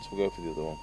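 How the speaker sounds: usual speaking voice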